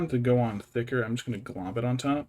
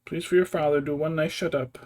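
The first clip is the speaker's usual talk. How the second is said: louder, higher coaxing plea to a noisy cat; raised, half-joking plea to a pet; pleading with the cat; 5 semitones higher, 7 dB louder than usual. Real, not acted.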